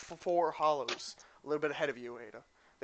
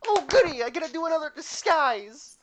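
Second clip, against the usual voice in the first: high, loud, gleeful squeal; thrilled to play dress-up; bubbly excited girl; 12 semitones higher, 7 dB louder than usual. Acted. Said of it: "she claps her hands and goes".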